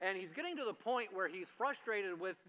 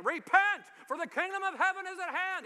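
booming cry, much higher and louder; prophet's shout; 13 semitones higher, 8 dB louder than usual. Retold or imitated. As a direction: call out to the crowd at the river like John the Baptist. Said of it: "he's coming out and he's saying".

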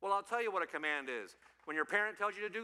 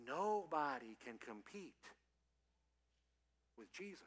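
hushed, deliberate emphasis; solemn declaration; 2 semitones lower, 6 dB quieter than usual. Real, not acted. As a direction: let each word land with weight. Solemn point after long pauses.

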